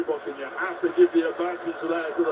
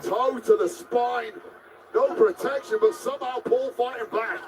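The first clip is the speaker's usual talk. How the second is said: wrestling commentator; 3 semitones higher than usual. Acted. Play-by-play of the match.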